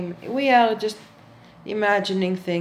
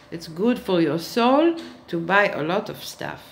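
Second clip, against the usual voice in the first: ordinary talk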